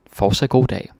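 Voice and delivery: cheerful cashier farewell; brisk shop greeting. Shown as a demonstration.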